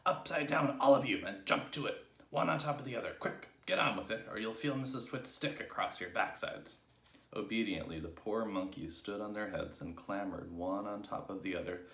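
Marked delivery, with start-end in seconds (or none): 0.0-6.8 barked orders, loud at first; fearsome monkey trainer. A narrator's reading. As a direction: bark commands at the troupe. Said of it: "barked in his fearsome monkey trainer's voice".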